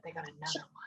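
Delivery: hushed mock-upset whisper. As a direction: whisper it like a hurt secret. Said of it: "very upset".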